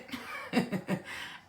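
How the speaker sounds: soft real chuckle; laugh. Real, not acted. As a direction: let out a quiet laugh. Laughs at own comment.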